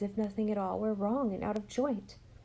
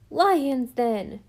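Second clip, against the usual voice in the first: put-on high, loud retort from a boy; shouted; 5 semitones higher, 9 dB louder than usual. A narrator's reading. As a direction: shout it back defiantly. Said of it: "shouted Jem".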